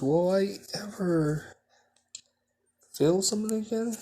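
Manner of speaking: plaintive. Acted. Girl asks mom, fearful.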